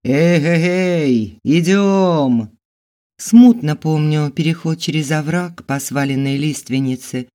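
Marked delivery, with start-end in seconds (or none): 0.0-2.6 slow, drawn-out loud hail; booming call. Acted. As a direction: call out across the forest. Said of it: "также громогласно ответил".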